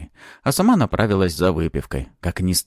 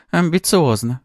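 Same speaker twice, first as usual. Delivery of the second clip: high-pitched, wry woman's remark; lightly scoff, pitched well up; amused scoff; 10 semitones higher, 4 dB louder than usual. A narrator's reading. Interviewer reacting to Maxim's ambitions, amused scoff. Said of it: "хмыкнула Лидия".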